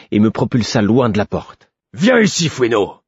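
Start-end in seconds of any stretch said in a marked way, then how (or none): 1.9-3.0 much higher, loud command; ordered. A narrator's reading. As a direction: call the pup sharply. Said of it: "ordonna-t-il". (ordre crié)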